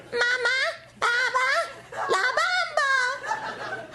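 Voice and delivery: put-on a baby proudly saying its first words; tiny child voice, very high and drawn out.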